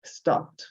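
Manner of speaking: "Stopped" as one syllable, ending in a T; "Stopped" pronounced "stopt"; silent E. Shown as a demonstration. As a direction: say "Stopped" with no E sound before the final D. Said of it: "Acá tampoco se pronuncia la E".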